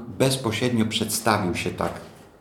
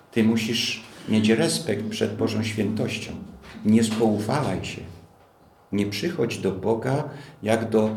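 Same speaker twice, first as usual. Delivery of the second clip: normal talking voice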